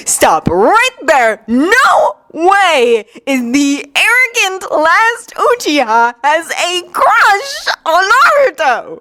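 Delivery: high, drawn-out disbelief; utterly incredulous, voice climbing. Acted. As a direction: draw it out in high-pitched disbelief. Incredulous reaction to a crush.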